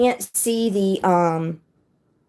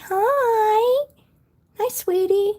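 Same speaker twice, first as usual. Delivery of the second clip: cooing pet greeting; much slower than usual. Real, not acted. Owner greeting a shy ball python, cooing. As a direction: coo a high-pitched hello to a beloved pet.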